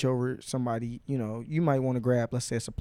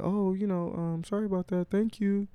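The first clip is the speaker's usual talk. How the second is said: lightly voiced apologetic stranger, pitched higher; imitated apology; 8 semitones higher than usual. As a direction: play a sheepish apology in a higher voice. Voicing the embarrassed, apologetic reply.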